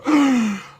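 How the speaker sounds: a high, startled gasp; sharp high gasp. Retold or imitated. Viewer seeing the picture gasps in shock. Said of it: "You go".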